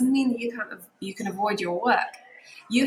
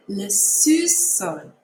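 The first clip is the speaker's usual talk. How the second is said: Le sous-sol very slowly, soo-sol; slow model; 4 dB louder, much slower than usual. Shown as a demonstration. Teacher very slowly models sous-sol for practice. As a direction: drag Le sous-sol out for copying. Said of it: "Make sure you're practicing saying it".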